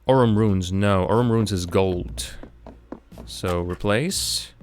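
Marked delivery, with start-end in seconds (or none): none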